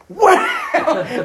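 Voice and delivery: high laughing wow; mock wow. Retelling the failed layup, a laughing high wow. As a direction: squeal it out through a laugh.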